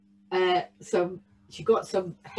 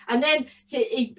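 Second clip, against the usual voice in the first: ordinary delivery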